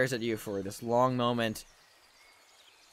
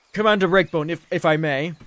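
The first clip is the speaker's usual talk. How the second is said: tag; 3 semitones higher, 6 dB louder than usual. Acted. Undrill steps in to intervene.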